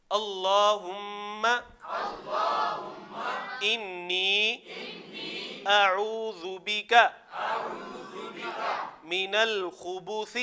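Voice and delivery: very slow, drawn-out Arabic supplication; measured teaching chant, phrase by phrase; repeat-after-me recitation. Shown as a demonstration. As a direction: stretch each phrase for students to repeat. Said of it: "میرے ساتھ پڑھیں گے".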